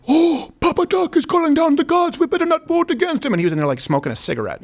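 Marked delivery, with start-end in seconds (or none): 0.1-3.3 high, breathless frightened gasp of a villager. Retold or imitated. A villager gasps to others in high mock fear. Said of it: "they'd be like".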